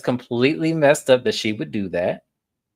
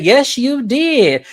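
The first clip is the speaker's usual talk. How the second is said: imitating Tawanda; 11 semitones higher, 7 dB louder, much slower than usual. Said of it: "so Tawanda said".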